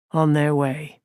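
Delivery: usual speaking voice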